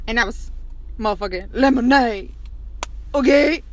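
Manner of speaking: loud overexcited influencer pitch; hyped fake ad. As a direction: sell lemonade drops like it changed your life. Hyped product-ad parody.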